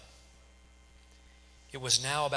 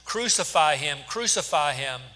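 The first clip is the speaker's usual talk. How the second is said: loud, raised chant-like demand; crowd shouting; 4 semitones higher, 8 dB louder than usual. A narrator's reading. Priests and guards demanding death from Pilate, shouting. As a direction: shout it hard, twice over. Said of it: "began shouting".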